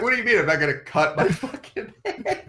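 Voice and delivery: high-pitched, put-on affronted voice; mock-outraged, protest in disbelief; indignant. Student objecting to the dorm RA's complaint, indignant. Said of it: "he's fucking indignant about it".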